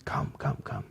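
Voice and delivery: soft whispered come, come, come; Lady Wisdom whispering her invitation. Acted. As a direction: beckon gently in a whisper. Lady Wisdom inviting, open hands.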